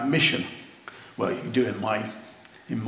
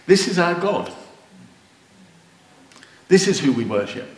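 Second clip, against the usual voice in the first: emotional; 4 semitones higher, 4 dB louder than usual. Real, not acted. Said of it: "I'm getting emotional".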